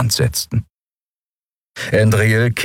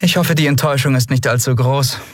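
self-ironic; 7 semitones higher than usual. Acted. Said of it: "selbstironisches Lächeln".

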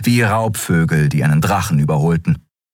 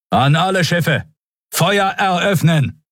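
rally the fleet to open fire; battle command; 12 semitones higher than usual. A narrator's reading. Admiral ordering all escort ships to attack, commanding.

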